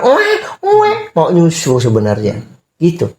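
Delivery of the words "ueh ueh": high mock newborn wail, ueh ueh; baby crying imitation. Father imitating a newborn crying for milk, high mock wail. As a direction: squeal like a hungry newborn baby. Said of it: "Anak bayi baru lahir".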